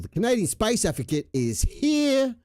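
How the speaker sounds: excited announcement. Real, not acted. Announcing a viewer arrival.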